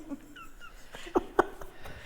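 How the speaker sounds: high, breathless wheezing laugh; helpless silent giggling fit; hysterical laughter. Real, not acted. Laughing at friend's mix-up.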